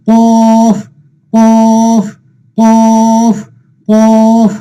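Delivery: কফ held long on one steady note, thick opening consonant; heavy drawn-out pronunciation. Shown as a demonstration. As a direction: chant each কফ slowly and heavily. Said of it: "একটু উঁচু মোটা করে পড়তে হবে".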